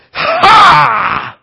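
high, loud triumphant "Ha!"; gleeful victorious cry; triumphant shout. Acted. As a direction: shout it like mercy gloating over judgment. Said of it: "stands on the chair ... and says".

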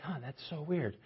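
soft, mock puzzled; a hint of relatives baffled; puzzled family. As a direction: muse it quietly, baffled. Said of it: "they're like".

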